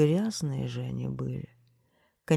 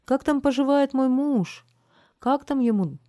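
high, loud anxious calling out; women shouting; 10 semitones higher, 7 dB louder than usual. A narrator's reading. Women shouting to the garbage man about husbands, calling out high. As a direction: call out shrilly high for news of a husband. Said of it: "Женщины кричали".

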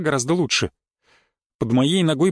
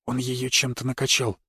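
soft hushed whisper; barely audible, quiet voice; 4 semitones lower, 6 dB quieter than usual. A narrator's reading. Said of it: "разговаривая едва уловимым шёпотом".

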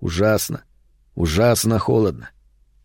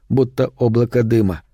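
usual speaking voice

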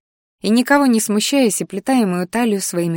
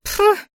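very high huffy pff; a sharp scornful puff; the embarrassed flower snorting in a high squeak; indignant snort; 14 semitones higher than usual. Acted. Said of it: "фыркнула она".

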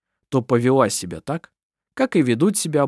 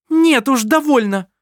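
high, loud furious outburst; loud high cry of having had enough; outraged cry; 12 semitones higher, 6 dB louder than usual. A narrator's reading. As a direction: shout as she jumps up. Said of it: "вскричала Керстин и вскочила".

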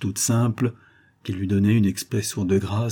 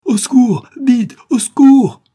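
much higher, much louder panicked scream; cries for help; 12 dB louder, slower than usual. Acted. Jacqueline screams for help as her father collapses. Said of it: "des cris se faisaient entendre".

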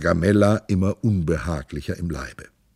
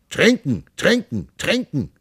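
a higher, lusty demand for drink; strong voice; 8 semitones higher than usual. Acted. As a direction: bellow it like a thirsty baby giant. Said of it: "mit kräftiger Stimme".

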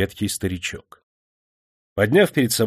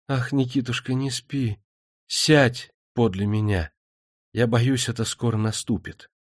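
moaning. A narrator's reading. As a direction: moan in fear and pain. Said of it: "простонала".

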